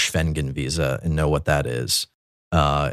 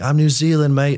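higher, louder mock New Zealand accent; 10 semitones higher, 6 dB louder than usual. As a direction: answer back like a cheeky Kiwi in a New Zealand accent. Kiwi soldier's reply voiced.

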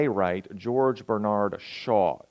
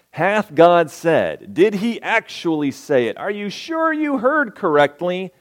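sow doubt with a raised, insinuating question; 6 semitones higher, 4 dB louder than usual. Acted. Voicing the serpent's doubting questions.

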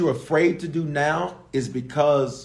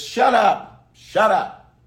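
sharp, louder command to yourself; aggressive shut up; 6 semitones higher, 4 dB louder, much slower than usual. Shown as a demonstration. Preacher showing the congregation how to silence their past, aggressive. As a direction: bark it at yourself. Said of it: "get a little aggressive with yourself".

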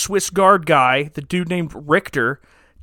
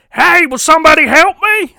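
higher and louder, a hammed-up movie-hero call for help; Tom Hanks impression; 12 semitones higher, 10 dB louder than usual. Langdon calling for help in the fountain, hammed-up Tom Hanks. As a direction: shout it like a bad Tom Hanks. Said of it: "That's my best Tom Hanks impersonation".

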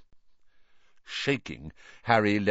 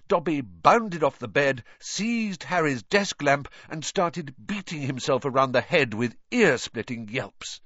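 usual speaking voice

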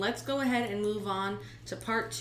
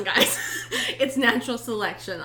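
laughing; 6 dB louder than usual. Real, not acted. Laughing at listeners.

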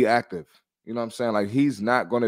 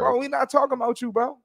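exasperated call-out; 13 semitones higher than usual. Real, not acted. Calling out commenter, pitch jump.

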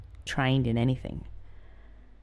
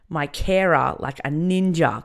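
ordinary delivery

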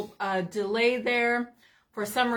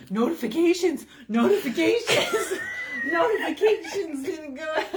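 mocking imitation; 6 semitones higher, 3 dB louder than usual. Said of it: "He's like".

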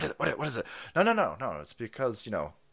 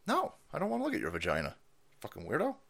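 quiet annoyed man; 9 dB quieter, faster than usual. Pretend. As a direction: mutter it, fed up. The guy answers in quiet voice.

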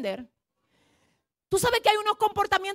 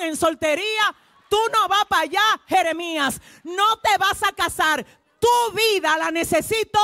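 raised, commanding voice calling Jeremiah; God's command; 3 semitones higher than usual. Acted. God commands Jeremiah not to marry, in a raised voice. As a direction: thunder the order down from heaven.

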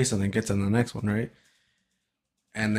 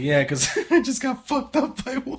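put-on higher, laughing drunk stranger at the urinal; drunk guy voice; 13 semitones higher than usual. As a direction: play the drunk guy, giggling through it. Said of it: "he's just like".

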